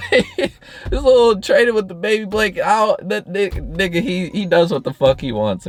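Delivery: ordinary talk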